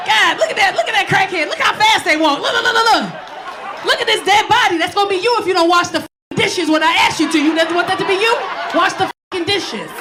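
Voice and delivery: put-on scare the kids into washing dishes; mock parent lecture.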